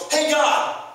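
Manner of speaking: high-pitched mock call. Preacher acting out facing away from God, calling out. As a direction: holler it as if to someone behind you. Calls to God behind him.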